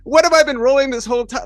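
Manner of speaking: high-pitched mock exasperation; hammed-up incredulous complaint; retold indignant protest. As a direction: protest in disbelief, pitched right up. Retells his protest to game master.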